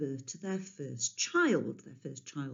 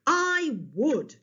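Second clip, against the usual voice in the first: booming king's voice, high and louder; 10 semitones higher, 6 dB louder than usual. A narrator's reading. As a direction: boom out eagerly. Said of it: "in his very loud and booming voice".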